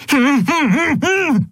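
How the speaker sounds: strained high laugh; pained laugh. A narrator's reading. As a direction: force out a laugh. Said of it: "brachte Zaylar hervor".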